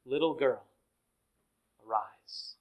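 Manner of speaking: soft, low tender call. Acted. Jesus waking the little girl, soft and tender. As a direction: gently wake her, like her own mother.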